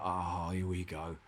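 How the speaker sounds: slow, quiet, resigned sigh of a line; weary here-we-go. Retold or imitated. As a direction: let it drop, already beaten. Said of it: "I was like".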